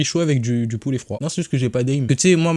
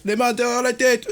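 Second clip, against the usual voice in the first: police officer; 9 semitones higher than usual. Pretend. Plays police officer making arrest.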